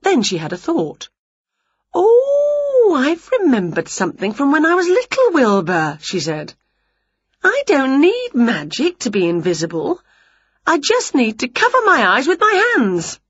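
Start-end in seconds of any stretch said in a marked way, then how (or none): 1.9-6.0 high, long "Oh" easing down; excited witch struck by an idea; high-pitched eager realisation; sudden bright idea. A narrator's reading. 7.4-13.2 eager scheming. A narrator's reading. Witch eagerly explains her plan.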